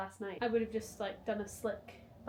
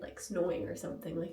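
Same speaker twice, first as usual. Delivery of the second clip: ordinary delivery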